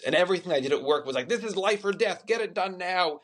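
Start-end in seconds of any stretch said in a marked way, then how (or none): 1.3-3.2 higher, pressing mock panic; hammed-up deadline urgency; sending up the film job's fake life-or-death urgency, higher; mock urgent boss. Mocking frantic film-work urgency.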